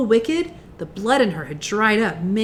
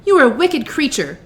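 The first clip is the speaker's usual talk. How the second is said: put-on raised, loud girl's accusation; angry cry; 5 semitones higher, 6 dB louder than usual. A narrator's reading. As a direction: accuse her loudly in anger. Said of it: "cried Dorothy".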